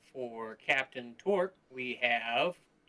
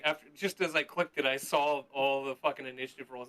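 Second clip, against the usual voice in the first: ordinary talk, nothing marked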